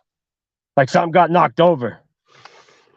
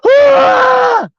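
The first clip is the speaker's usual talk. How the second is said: high, loud, sustained shriek; girlish scream; 6 dB louder than usual. Acted. Man facing the eyeless woman, high girlish scream. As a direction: scream like a little girl. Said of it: "he screams loud, like a little girl".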